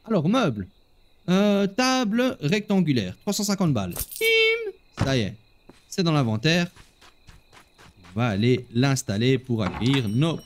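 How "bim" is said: high-pitched "bim!" sound effect; cartoonish purchase ding; playful bim. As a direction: pop out a high, ringing bim. Sound effect on buying the table.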